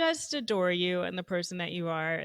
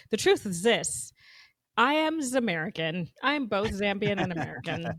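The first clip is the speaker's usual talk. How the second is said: proud reveal; 7 dB louder than usual. Acted. Dramatic reveal of identity.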